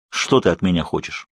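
ordinary delivery, nothing marked

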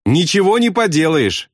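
high-pitched narrated shout; shout back. A narrator's reading. Pilot answers bombardier over engines, high shout. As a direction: call back high, without real volume. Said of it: "крикнул ему в ответ Кроха Сэмпсон".

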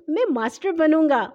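put-on pleased child piping up; happy. A narrator's reading. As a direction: claim the role gladly. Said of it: "ہاشم خوش ہو کر بولا".

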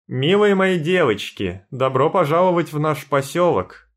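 loud, high, ringing and confident. A narrator's reading. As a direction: ring out a warm welcome to the crowd. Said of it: "звенящий голос председательши".

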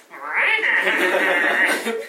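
loud rising evil cackle; skeleton cackle. Acted. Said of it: "The skeleton cackles".

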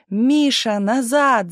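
high, drawn-out calling out; urgent cry. A narrator's reading. Tumanov calls Misha back as shelling starts, crying out. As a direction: call someone back urgently, no great volume. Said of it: "закричал Туманов".

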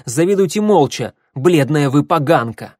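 loud, high-pitched comeback; heated, scornful retort; angry insult. A narrator's reading. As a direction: hurl the insult loudly. Furious insult in a quarrel.

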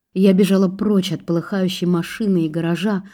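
usual speaking voice

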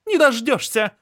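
высокий громкий вызов; выкрикивает отказ с вызовом; defiant outburst. Acted. Anzhela refuses, heated. (резкий отказ)

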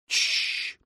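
sharp voiceless shush; shushing hiss. Acted. Ilya hushes in the dark, hissing softly. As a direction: hiss for silence. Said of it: "прошипел Илья".

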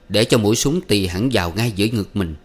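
ordinary delivery

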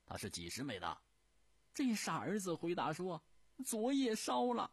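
高亢、活泼的说笑话腔调; 提高嗓门，绘声绘色讲故事; 李莲英讲笑话. Acted. 笑话继续. (高调说书腔，讲傻儿子笑话)

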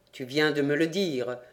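ordinary delivery, nothing marked

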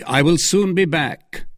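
calling out. A narrator's reading. Musketeer riding off from his friends, calling out mildly. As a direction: toss a promise back to waiting companions. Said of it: "cried he".

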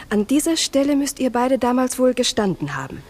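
usual speaking voice

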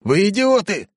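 high hysterical yell. Acted. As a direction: shriek in disbelief. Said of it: "истерические нотки".

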